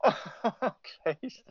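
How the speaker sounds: high words squeezed out between laughs; laughing exasperation. Real, not acted. Guest overcome by the advert joke, helplessly laughing. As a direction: gasp it out while laughing.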